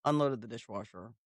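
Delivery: mock proud daughter, soft. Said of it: "she's like".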